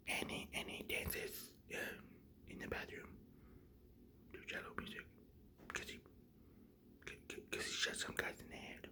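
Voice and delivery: barely audible, voiceless storytelling; whispered narration. A narrator's reading. Teller describing bathroom dance, very faint whisper.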